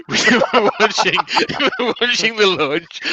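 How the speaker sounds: words breaking into giggles; laughing. Real, not acted. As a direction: laugh through the line. Laughing through mock commentary.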